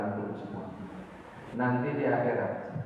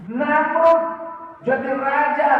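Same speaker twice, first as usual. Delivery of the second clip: loud preaching; 9 dB louder, slower than usual. Acted.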